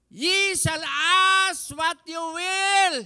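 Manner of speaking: loud, high, slow proclamation; preacher declaring the promise; preaching. Acted. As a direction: declare it slowly.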